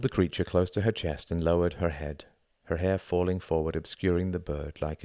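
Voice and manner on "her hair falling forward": usual speaking voice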